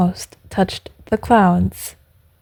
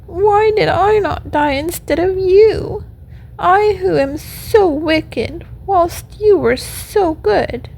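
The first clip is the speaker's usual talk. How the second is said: high, plaintive little puppet voice; acted tearful pleading, pitched well up; weeping puppet lament; 6 semitones higher than usual. A narrator's reading. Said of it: "as he wept".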